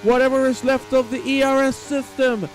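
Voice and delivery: excited finish-line call; live race commentary. Acted. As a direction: race announcer building in pitch toward the finish.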